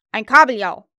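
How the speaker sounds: very loud, falling statement; loud retort. A narrator's reading. Peter insults Hook from hiding, loud retort. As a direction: declare it as a final verdict. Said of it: "erwiderte die Stimme".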